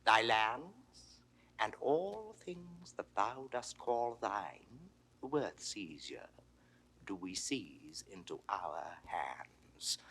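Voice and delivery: low, hushed menace with long pauses; soft-spoken, dangerous authority; quiet threat. Acted. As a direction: pronounce the sentence softly and deliberately. Ruler threatens to seize lands.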